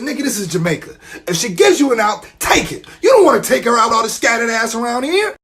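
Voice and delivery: half-shouted advice to a buddy; hyped urging. Retold or imitated. Urging cousin to leave girlfriend.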